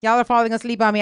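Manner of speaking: usual speaking voice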